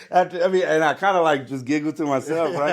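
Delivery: loud, high, real laughter in the voice; laughing while talking. Real, not acted. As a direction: keep talking through leftover laughter. Still laughing after own punchline.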